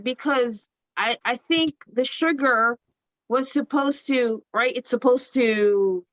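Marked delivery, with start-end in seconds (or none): none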